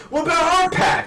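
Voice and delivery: put-on huffy, needy protest; Law, tsundere. Law whines to Luffy about their pact, mock tsundere. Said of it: "Law gets so tsundere in this chapter".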